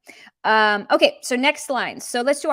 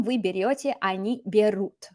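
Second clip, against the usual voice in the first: usual speaking voice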